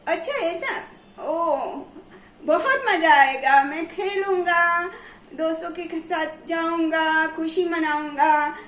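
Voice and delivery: put-on loud, cheering little boy; loud, jubilant child thrilled to stay home and play; gleeful child. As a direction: shout it like a kid let off school. Child cheers about no school.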